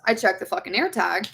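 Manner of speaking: ordinary talk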